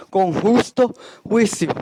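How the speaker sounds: ordinary talk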